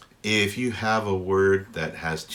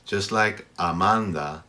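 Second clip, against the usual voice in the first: normal talking voice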